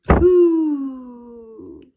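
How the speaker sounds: loud, disgusted "Pooh" at a stinky pig; smelly pooh. Shown as a demonstration. Teacher showing children the pig sound, loud and disgusted.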